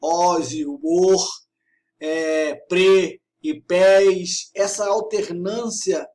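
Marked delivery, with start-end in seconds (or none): 0.0-1.3 "os" and "or" drawn out loudly; vowel timbre demo. Shown as a demonstration. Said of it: "timbre fechado ou com timbre aberto". 2.7-4.5 "pre" with closed E, "pés" with open E, both long and loud; closed versus open. Shown as a demonstration. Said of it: "timbre fechado ou com timbre aberto".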